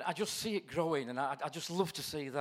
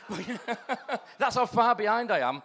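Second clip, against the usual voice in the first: laughing; 7 semitones higher, 9 dB louder, slower than usual. Real, not acted. Joking about being behind.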